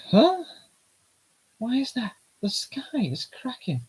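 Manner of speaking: put-on high-pitched girly character voice; squeaky young girl voice.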